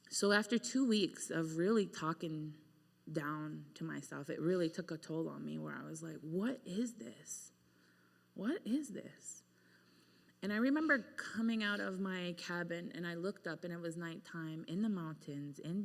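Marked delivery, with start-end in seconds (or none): 6.2-9.4 hushed, slow, to herself; despairing whisper. Retold or imitated. To herself alone at night in the cabin, a despairing whisper. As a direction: whisper it in despair, barely voiced.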